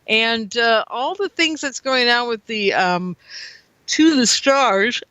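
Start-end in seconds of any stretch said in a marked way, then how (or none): 3.9-5.0 words stretched out through a real yawn; a tired yawn swallowing the words. Real, not acted. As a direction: say it mid-yawn, slow and drawn out. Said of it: "I'm yawning".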